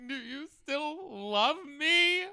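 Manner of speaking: high, slow, pleading needy voice; needy whiny plea. Retold or imitated. Man to his partner, pleading for reassurance. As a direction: stretch it out like a clingy partner begging. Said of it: "I'm that kind of person who's like".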